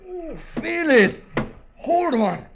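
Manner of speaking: rising, eager and breathless over the gold; greedy excitement. Acted. Keatman gloating over the gold bricks, rising excitement.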